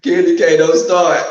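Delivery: loud, laughing call to a viewer; half-laughing warning shout; playful loud warning. Real, not acted. Calling out to a viewer.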